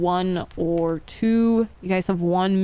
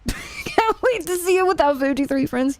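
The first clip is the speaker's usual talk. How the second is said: laughing; 10 semitones higher than usual. Real, not acted. Laughing while reading chat.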